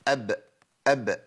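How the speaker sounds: أب with a light echo after the ب; qalqala demo. Shown as a demonstration. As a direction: bounce the closing ب of أب. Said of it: "ما هي دي القلقلة".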